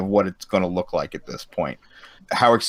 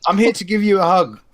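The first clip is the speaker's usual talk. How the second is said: mock South African accent; 9 semitones higher than usual. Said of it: "I didn't give him the real South African".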